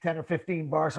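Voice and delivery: normal talking voice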